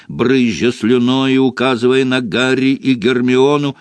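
ordinary delivery, nothing marked

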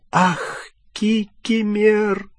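a pitying cry of his name; moaning in tears. A narrator's reading. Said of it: "простонала расплакавшаяся Гермиона".

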